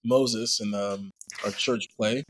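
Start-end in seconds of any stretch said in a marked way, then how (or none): none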